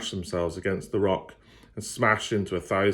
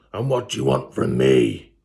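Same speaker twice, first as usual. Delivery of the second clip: low, loud demanding demon voice; fire fiend; 7 semitones lower, 5 dB louder than usual. Acted. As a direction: loom over her with the question. Fire fiend demands her purpose.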